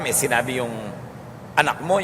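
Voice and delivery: usual speaking voice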